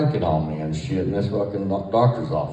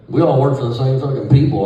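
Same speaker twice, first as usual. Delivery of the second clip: loud, raised voice pushing back; retold heated comeback; 6 semitones higher, 6 dB louder than usual. Inmate telling off guards who skipped the search, loud retold outburst. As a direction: push back hard at the guards.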